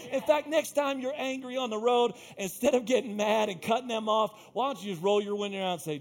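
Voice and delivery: high, driving sermon fervour; full-force preaching. Real, not acted. Preacher urging believers to sow seed, fervent preaching. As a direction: exhort the congregation with mounting energy.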